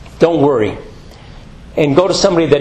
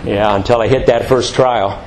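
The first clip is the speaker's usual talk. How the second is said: ordinary talk, nothing marked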